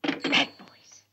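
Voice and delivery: breathless whisper of reproach; whispered scold. Acted. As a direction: hiss it like a cross nanny.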